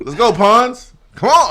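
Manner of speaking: high, loud hype shout; excited hype. Real, not acted. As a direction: fire him up loudly. Hyping up the player.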